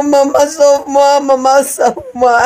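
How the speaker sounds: ordinary talk, nothing marked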